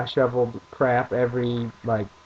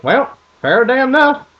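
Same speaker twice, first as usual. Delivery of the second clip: high, loud country dad voice; 12 semitones higher, 8 dB louder than usual. Retold or imitated. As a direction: play the dad shrugging it off. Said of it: "And he goes".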